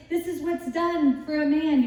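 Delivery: a light mock herald's cry; proclaiming herald. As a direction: announce it like a herald in the street. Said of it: "you should make everybody say".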